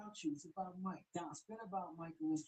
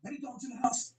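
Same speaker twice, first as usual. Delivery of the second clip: louder hype call to the room; hype shout; 7 semitones higher, 13 dB louder than usual. Acted. Dad pumping up his kids, a louder hype shout. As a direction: roar it to pump up the room.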